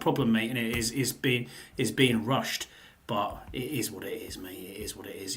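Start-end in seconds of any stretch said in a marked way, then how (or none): 3.1-4.6 whispered resignation. Real, not acted. Resigned aside to co-host. 4.6-5.4 whispered resignation. Real, not acted. Resigned aside repeated.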